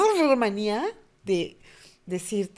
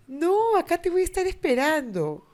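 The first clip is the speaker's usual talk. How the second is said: much higher, sing-song promise; mock reassuring mother; 9 semitones higher than usual. Mother promising to wait, much higher. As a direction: fake reassurance.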